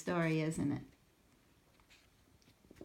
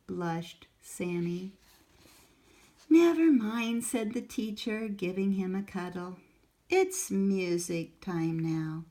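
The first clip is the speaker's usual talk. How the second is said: put-on reading to children; 3 semitones higher, 6 dB louder, slower than usual. A narrator's reading. Reads picture book aloud.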